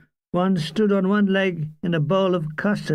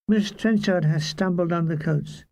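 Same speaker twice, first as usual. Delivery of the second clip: plain reading voice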